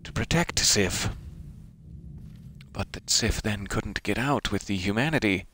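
usual speaking voice

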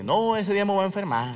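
high mocking girl's voice; stupid excuse. Girls turning down his invitation, mock excuse. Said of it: "me daban excusas bien estúpidas de".

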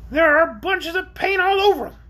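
high, complaining creature voice; shrill orc. A narrator's reading. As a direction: fuss over sore feet shrilly. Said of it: "his shrill voice".

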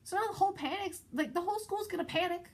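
exasperated. Real, not acted. Describing school panic.

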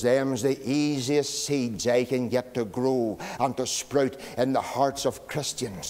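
low, slow, hushed devil. Retold or imitated. Devil boasts to Moody about discord, low and hushed. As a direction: savour each word like a sly devil. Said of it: "He says".

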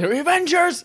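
high, ringing mock battle cry; rally the heroes like Captain America; imitating a hero's rallying call; Captain America shout. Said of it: "and he goes".

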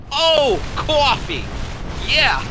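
high, drawn-out New York exclamation; New York accent. Retold or imitated. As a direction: mimic a loud New Yorker suddenly understanding. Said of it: "that's the accent that they've got".

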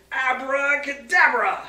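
drawn-out loud Abracadabra; booming magic word. Acted. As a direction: call the spell slowly and loudly.

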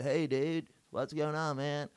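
mock Californian surfer drawl; put-on American accent. Irish emigrant mimicking their old self after two years in America, fake American accent. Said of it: "she just still had northern English accent ... I was just like".

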